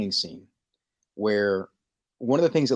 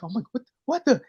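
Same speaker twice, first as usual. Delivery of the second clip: stammering shock; 12 semitones higher than usual. Retold or imitated.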